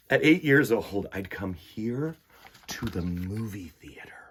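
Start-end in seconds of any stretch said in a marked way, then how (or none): 1.1-4.2 hushed, voiceless secret tone; whisper. Acted. Child sharing a secret spot with the listener, whispering. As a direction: whisper as if in a dark theater.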